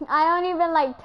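ordinary talk

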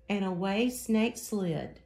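ordinary delivery

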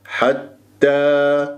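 حتى drawn out with a clear open a; open final vowel. Shown as a demonstration. Teacher models the word, open long a. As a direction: keep حتى open, not tilted to e. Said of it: "مش حتى".

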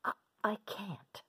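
breathy hesitant whisper. Acted. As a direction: falter in a whisper.

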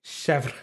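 Chèvre said as one syllable, "shevr"; French pronunciation. Shown as a demonstration. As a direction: drop the "ray" and end Chèvre on the consonants. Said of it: "that's how they say it in French".

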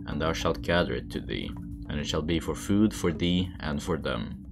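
ordinary delivery, nothing marked

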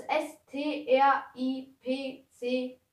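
slow letter by letter spelling; spelling out a sign name letter by letter. Shown as a demonstration. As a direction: spell each letter slowly, puzzling it out. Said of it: "wie spricht man das aus?".

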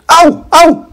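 high sharp yelps; meteorite yelping. A narrator's reading. Meteorite poked by pen, high yelp. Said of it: "the meteorite said".

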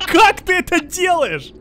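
high, loud, slow amazed shout. Real, not acted. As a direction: yell it in disbelief. Friend doing crazy stunt. (изумлённо и громко)

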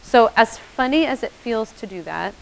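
ordinary delivery

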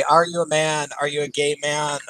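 usual speaking voice

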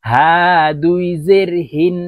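হা and হিন with a light h from the bottom of the throat; small ha from throat's start. Shown as a demonstration. Teacher modelling small ha, light long হা from the throat's start. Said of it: "ছোট হা হলকের শুরু থেকে".

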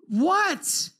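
high, fed-up reply; re-enacted irritated parent; exasperated snap. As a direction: fire it back upstairs, out of patience. Exasperated father answering repeated calls.

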